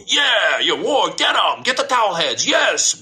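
high-pitched gung-ho crowd imitation; mock war cheer. Mimicking Americans quick to cheer for war, mock gung-ho. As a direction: whoop it up in mock bloodlust.

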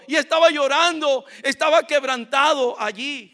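high, loud, pleading sermon voice; impassioned preaching. Real, not acted. Preacher voicing broken Jacob admitting he cannot, impassioned preaching. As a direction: cry out the surrender with full force.

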